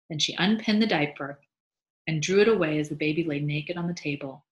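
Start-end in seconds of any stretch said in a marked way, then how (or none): none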